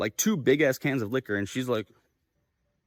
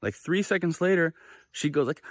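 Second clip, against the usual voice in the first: ordinary delivery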